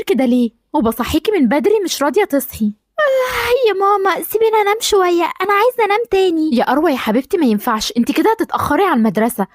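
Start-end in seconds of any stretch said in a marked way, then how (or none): 3.0-6.6 put-on a sleepy girl wails to her mother, crying and whining; whiny crying. Sleepy daughter begs mother to let her sleep, crying whine.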